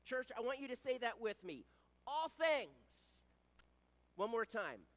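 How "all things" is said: loud, raised call-and-response; emphatic group recitation; congregational call. Real, not acted. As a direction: lead the church in saying it together. Leading congregation to repeat together.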